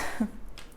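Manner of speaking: soft, breathy laugh; short real chuckle under the breath. Real, not acted. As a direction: let a quiet laugh slip out. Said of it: "I'm laughing because".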